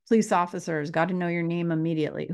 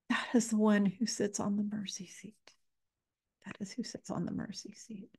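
whisper; 2 semitones higher, 8 dB quieter than usual. Real, not acted. Reverent whisper of holy name.

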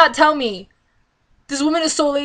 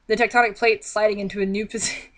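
ordinary talk

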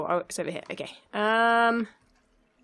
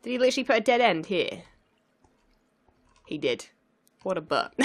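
ordinary delivery

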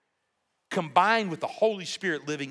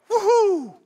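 a loud, much higher "woohoo" like a fan at a game; excited cheer; 10 dB louder than usual. Shown as a demonstration. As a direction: whoop it like your team just scored. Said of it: "Wow, he's excited".

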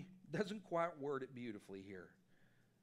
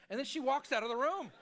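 laughing; 9 semitones higher, 8 dB louder than usual. Real, not acted. Laughing while telling story.

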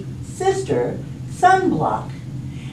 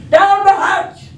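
high, loud group echo; crew yelling; 13 semitones higher, 7 dB louder than usual. Acted. Crew echoes Braidbeard at dinner, yelling. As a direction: yell back the pirate toast. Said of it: "everybody yelled".

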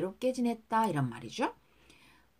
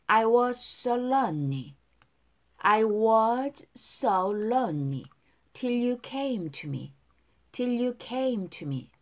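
slow, even teacher's model line; slow model reading; much slower than usual. Shown as a demonstration. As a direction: spell each word out slowly. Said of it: "두 번씩 따라 할게요". (천천히 또박또박 시범 발음)

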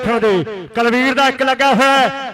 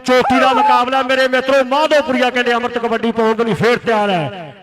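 ordinary talk, nothing marked

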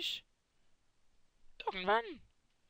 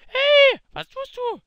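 sharp loud call; 6 semitones higher, 18 dB louder, slower than usual. Acted. Stops Gomme plugging his channel.

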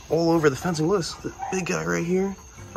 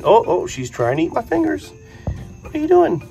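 high, louder startled squeal; startled pet talk; 10 semitones higher, 4 dB louder than usual. Real, not acted. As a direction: yelp as it nips your fingers. Croc snaps at his fingers.

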